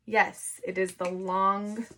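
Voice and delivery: usual speaking voice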